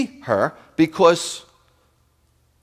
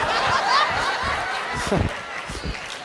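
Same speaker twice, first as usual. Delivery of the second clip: high, breathless wheeze of a laugh; high wheezing laugh; 7 dB quieter than usual. Real, not acted. Rabbi cracking up after pranking Janet with a high wheezing laugh. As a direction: let the laugh squeak out high and airless.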